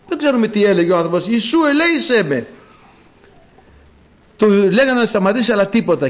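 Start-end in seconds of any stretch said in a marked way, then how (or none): 1.3-2.5 high, raised plea; acted crying out. Blind man cries to Jesus for mercy, high pleading call. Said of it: "φώναζε".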